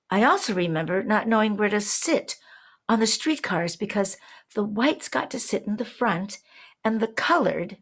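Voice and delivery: ordinary talk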